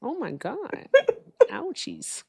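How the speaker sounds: hammed-up pained squeal; whiny mock complaint; mock whimper. Retelling painful staircase descent.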